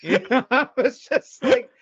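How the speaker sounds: real laughter while talking; laughing. Real, not acted. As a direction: crack up as you say it. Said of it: "laughing while telling".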